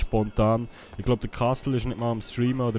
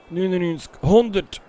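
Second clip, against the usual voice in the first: strained, louder final count; straining effort; 7 semitones higher, 5 dB louder than usual. Real, not acted. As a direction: push out the last reps. Finishing a hard workout set.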